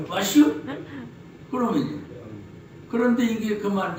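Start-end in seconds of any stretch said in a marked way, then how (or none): 0.1-0.6 a light Chungcheong "와슈?", drawn-out rising ending; Chungcheong dialect. Shown as a demonstration. Preacher demonstrates the Chungcheong "와슈?" in a light Chungcheong accent. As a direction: say "와슈?" like a Chungcheong countryside barber. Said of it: "충청도 말로".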